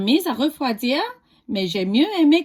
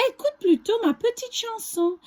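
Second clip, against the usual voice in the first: higher cheeky galette voice; 10 semitones higher than usual. Acted. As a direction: coax the wolf to listen. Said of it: "dit la galette".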